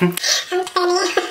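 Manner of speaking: very high, slow, giggly voice; hammed-up coy squeal; coy mock giggle. Answering the guy who asks his age, coy high giggle.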